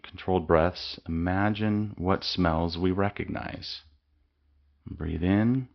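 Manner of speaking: ordinary delivery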